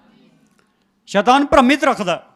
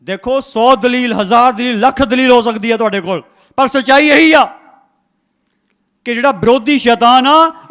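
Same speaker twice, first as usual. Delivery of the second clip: preaching at full force; 2 semitones higher, 7 dB louder than usual. Real, not acted. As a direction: drive each point home to the congregation.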